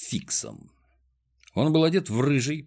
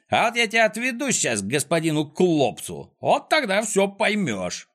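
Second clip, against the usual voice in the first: raised-pitch menacing warning; Фикс, threatening; 8 semitones higher than usual. A narrator's reading. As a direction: threaten a captive, drawing out the last words. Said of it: "пригрозил Фикс". (с угрозой, повышенным тоном)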